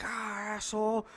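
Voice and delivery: thin, high imitation of a cursing driver; imitated angry driver. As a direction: mimic a driver cursing from his car. Honking driver yells insult.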